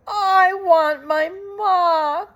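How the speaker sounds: high, slow wailing plea; a child crying for her mother. Acted. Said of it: "cried Mig".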